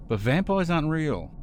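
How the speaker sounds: high, nervous disbelief. Acted. The stranger realising the vampires are real, high and nervous. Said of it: "the stranger nervously says".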